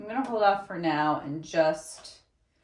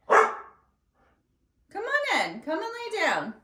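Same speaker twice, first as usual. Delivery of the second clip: high, slow coaxing pet voice; pet talk; 12 semitones higher, 3 dB louder, slower than usual. Real, not acted. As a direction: sweet-talk the dog over. Coaxing the dog to her.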